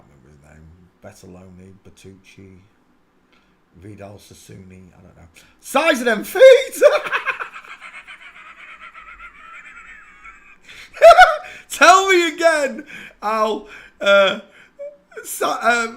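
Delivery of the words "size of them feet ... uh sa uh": squealing with laughter, words breaking up; cracking up, barely getting words out; laughing hard. Real, not acted. Laughing at the size of the feet in a photo.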